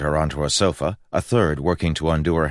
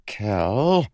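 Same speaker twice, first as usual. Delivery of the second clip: warning; 5 semitones higher than usual. Acted. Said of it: "warned Rye".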